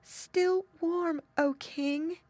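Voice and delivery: high, thin, halting reply; faint gasp. A narrator's reading. Numb girl answering King Frost, high faint gasp. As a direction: gasp it out high, stiff with cold. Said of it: "she could just gasp".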